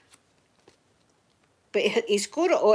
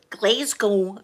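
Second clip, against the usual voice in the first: imitated American "Glasgow", drawn out slowly; mock American "Glasgow" with a long first vowel; American pronunciation; 5 dB louder than usual. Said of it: "say it a funny, funny way".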